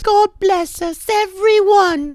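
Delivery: put-on very high, frail little-girl voice, slow on the last word; high-pitched Tiny Tim blessing; sickly little girl. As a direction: squeak it out like a fragile waif. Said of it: "I can already hear her saying".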